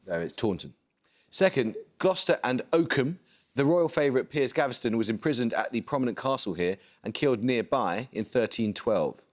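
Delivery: usual speaking voice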